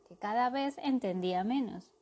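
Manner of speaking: ordinary delivery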